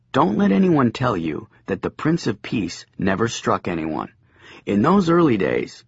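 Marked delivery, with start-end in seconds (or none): none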